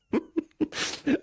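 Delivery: high, helpless laugh; real fit of giggles. Real, not acted. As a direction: laugh you know you shouldn't have. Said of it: "I shouldn't be laughing".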